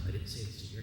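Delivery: usual speaking voice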